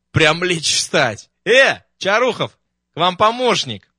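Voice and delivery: громко и намного выше, зовёт через помещение; окликни напарника издалека; ошарашенный окрик. Acted. Смарагдин ошарашен, зовёт Чарухова. (громкий изумлённый окрик)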